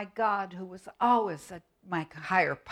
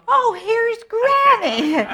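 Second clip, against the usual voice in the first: much higher, loud quavery granny; old granny voice; 14 dB louder, slower than usual. Pretend. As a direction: greet them as a fussing granny. Granny puppet appears.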